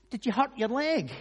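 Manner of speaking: high, loud mock-curious question; naive obvious question. Friend meeting someone on crutches, mock-naive question. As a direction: ask the obvious as if clueless.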